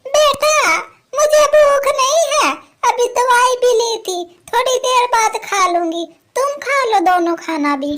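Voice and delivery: put-on a gentle old mother-in-law declining food. Old mother-in-law gently tells son and daughter-in-law to eat.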